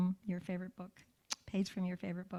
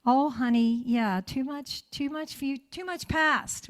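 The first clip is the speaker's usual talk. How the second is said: a light, high mock-soothing grownup voice; a hint of a condescending grandparent; mock dismissive parent; 6 semitones higher, 5 dB louder than usual. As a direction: brush off the child's pain, mockingly. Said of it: "you can't say".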